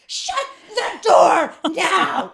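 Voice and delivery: drawn-out mock scream, each word stretched; screaming stranger. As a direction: imitate a man screaming an order, high and loud. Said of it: "some guy screams at me going".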